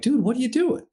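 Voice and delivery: can't believe what your friend is doing; alarmed disbelief. Retold or imitated. Pilot calls to Phil over the radio, high and incredulous.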